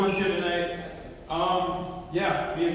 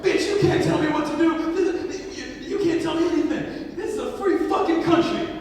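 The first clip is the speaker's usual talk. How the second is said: loud, high-pitched mock rant; acted angry tirade; 9 semitones higher, 5 dB louder than usual. Man goes off on the kid's mom, ranting. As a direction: go off as the indignant passenger. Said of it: "this dude just goes off on".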